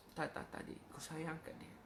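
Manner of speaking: put-on soft, breathy reassurance to a child; comforting a hurt boy in a whisper; tender whisper. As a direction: hush him gently, almost whispering. Ustaz consoling the boy tenderly.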